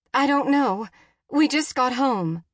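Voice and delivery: high, loud, helpless answer; frantic mother on an emergency call; panicked reply. A narrator's reading. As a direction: answer in rising alarm.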